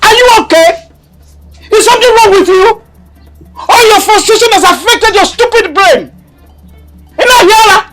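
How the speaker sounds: high, loud shouting rebuke; enraged mother yelling; furious tirade. Acted. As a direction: tear into her daughter. Mother berating daughter, shouting.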